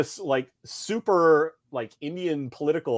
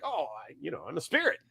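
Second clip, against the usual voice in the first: lightly voiced, offhand store guy, softer; Jiffy Lube guy; 9 dB quieter, faster than usual. Spirit warrior answers who he is, offhand store guy. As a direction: toss it off like an ordinary shop worker. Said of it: "the guy down the store at Jiffy Lube".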